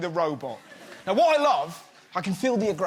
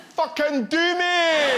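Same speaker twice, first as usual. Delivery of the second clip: high, slow, drawn-out lustful cry; swooning plea; 7 semitones higher, 5 dB louder, much slower than usual. Pretend. Comic swooning over an Italian robot voice, high slow lustful cry. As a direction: beg it like you are melting.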